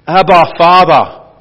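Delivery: slow, loud anguished cry of Abba, Father; a son crying out loud to his father in anguish; crying out to God. Acted. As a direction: call out to the Father in agony.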